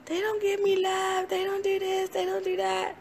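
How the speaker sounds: high, whining voice of someone feeling cheated of love; whiny complaint. Retold or imitated. Person complaining their love isn't returned, high whine. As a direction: whine it like a hurt child. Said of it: "And then you say".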